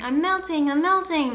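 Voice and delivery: high, wailing witch cry; Wicked Witch of the West. Acted. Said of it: "sounds just like the Wicked Witch".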